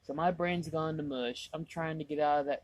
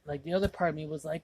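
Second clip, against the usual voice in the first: ordinary talk, nothing marked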